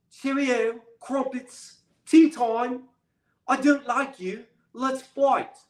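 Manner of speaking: lightly put-on British accent with clipped tea-time words; bad British accent. Vlogger mocks Anthony Joshua calling him out, bad British accent. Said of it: "Excuse my bad British accent".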